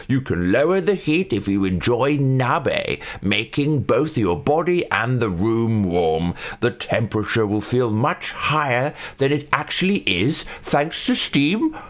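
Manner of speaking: slow, exaggerated posh British reading; posh British accent, stretching the words. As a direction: read it out in drawn-out posh British. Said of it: "I'm reading this in a posh British accent".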